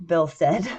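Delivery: usual speaking voice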